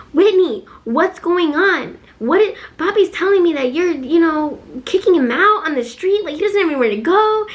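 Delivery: put-on high-pitched, pleading kid sister; upset little sister. Little sister calling about dad being kicked out, high, upset imitation.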